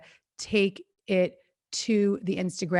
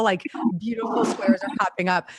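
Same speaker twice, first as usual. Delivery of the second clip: usual speaking voice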